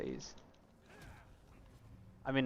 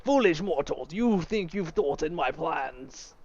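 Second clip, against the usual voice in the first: cold rasping evil emperor; 3 semitones higher, much slower than usual. Acted. Mock boss villain lines.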